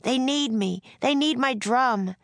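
put-on high, distressed boy's insistence; insist, upset but not shouting; increasingly upset. A narrator's reading. Drummer boy pleads for his duty, upset. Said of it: "He sounded more and more upset".

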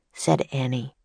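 ordinary delivery, nothing marked

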